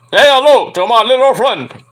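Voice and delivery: loud put-on Scarface voice; Al Pacino Scarface. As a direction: growl it like Tony Montana. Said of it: "Al Pacino".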